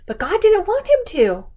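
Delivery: usual speaking voice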